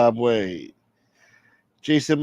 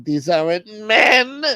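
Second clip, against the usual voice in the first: high, slow, rising cry; mock wailing; 12 semitones higher, 4 dB louder, slower than usual. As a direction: wail it theatrically to the end. Acted lament in a skit.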